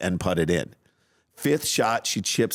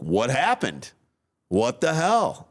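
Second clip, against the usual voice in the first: pitched well up, slowing on the second question, like an angry outburst; mock angry parent; 6 semitones higher, slower than usual. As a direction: replay the angry blurt at the kid, slowed down. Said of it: "instead of me being like".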